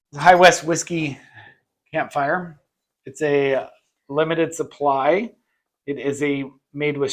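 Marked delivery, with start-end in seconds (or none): none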